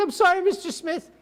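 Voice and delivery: high-pitched imitation of an apology; retold high, pleading sorry; apologetic prosecutor. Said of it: "She goes".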